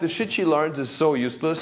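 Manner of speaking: ordinary delivery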